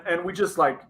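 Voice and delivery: ordinary delivery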